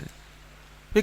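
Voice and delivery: a breathy whispered call. Acted. The sage gently addresses the weeping young man in a whisper. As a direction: lean in and whisper the word.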